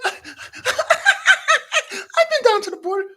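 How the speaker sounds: high, laughing mock answer; mock Harris laughing. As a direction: giggle through the dodge. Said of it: "She's like".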